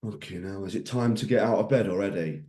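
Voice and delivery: mock-groggy groan, drops off quiet; a sleepy grumbler hating the alarm; groggy moaning. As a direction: moan like you just dragged yourself awake. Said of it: "you can wake up this morning and you can think".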